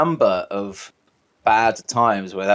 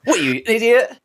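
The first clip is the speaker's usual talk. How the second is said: high-pitched mock exasperation; hammed-up insult on the edge of laughing; sarcastic scolding; 11 semitones higher than usual. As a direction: jokingly berate them as a snarky bottle. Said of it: "if they're really sarcastic".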